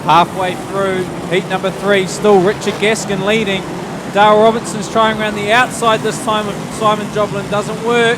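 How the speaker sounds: brisk live race call; motorsport commentator. Acted. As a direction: call the heat lap by lap.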